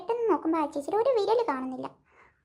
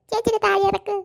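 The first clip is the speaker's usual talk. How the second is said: usual speaking voice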